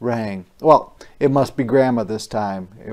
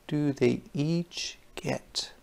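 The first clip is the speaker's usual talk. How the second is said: whisper; 10 dB quieter, slower than usual. Acted. Asking young listeners a question.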